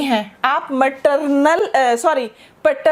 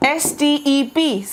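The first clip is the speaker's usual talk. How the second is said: slow letter-by-letter spelling; dictating letters slowly; spelled out; slower than usual. Shown as a demonstration. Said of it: "spelling out a word".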